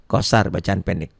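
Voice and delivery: ordinary talk, nothing marked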